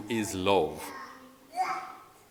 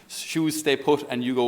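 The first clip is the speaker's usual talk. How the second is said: normal talking voice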